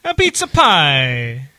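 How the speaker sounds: slow hammed-up Italian accent; mock Italian accent. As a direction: drawl A pizza pie like a cartoon Italian. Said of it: "Italian accent".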